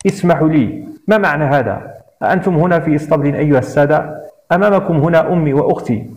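ordinary delivery

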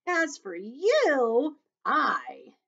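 loud, high, showy centipede voice; boastful centipede. Acted. Centipede showing off to the room, boastful. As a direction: crow it out loudly, stretching the words.

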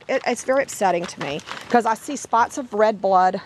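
ordinary talk

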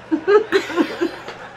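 short high laugh; giggle. Real, not acted. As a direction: crack up again.

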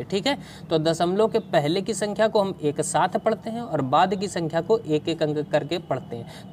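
ordinary talk, nothing marked

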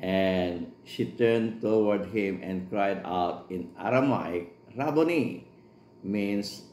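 slow, measured Bible reading; scripture reading. Acted. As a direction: read the verse slowly and solemnly. Reads scripture aloud in English.

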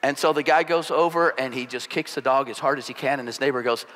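ordinary talk

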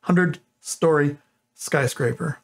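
"hundred-story skyscraper" spelled out slowly, each syllable placed; slow down to land "skyscraper" clean; careful slow "hundred-story skyscraper". Real, not acted. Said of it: "That is not easy to say".

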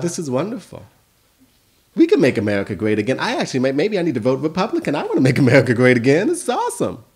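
a silly, starry-eyed thrill about the slogan, fast in places; mock naive excitement. Principal mocking his own naive hopes, gleefully excited. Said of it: "because my mind's a little silly".

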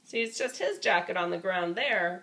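ordinary delivery, nothing marked

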